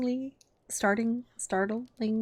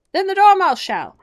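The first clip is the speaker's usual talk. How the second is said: much higher and louder; cried out; 12 semitones higher, 13 dB louder than usual. A narrator's reading. Hatter and Hare crying out to Alice, loud and high. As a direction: shout it out together. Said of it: "they both cried".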